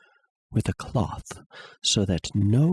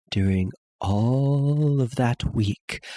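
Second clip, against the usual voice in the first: ordinary delivery, nothing marked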